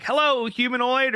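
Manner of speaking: a hint of a high, silly greeting; goofy voicemail greeting. Retelling his voicemail greeting to friends, lightly mimicked. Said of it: "my voicemail is something like".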